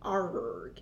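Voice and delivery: put-on low pirate arrgh in a pirate accent; pirate growl. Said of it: "hosting things with a funny accent".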